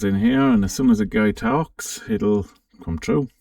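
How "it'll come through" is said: ordinary talk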